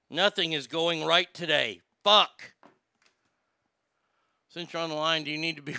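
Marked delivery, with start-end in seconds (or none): 0.1-2.5 frustrated outburst. Real, not acted. Streamer cursing the failed call, frustrated. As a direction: let the fed-up anger spill over.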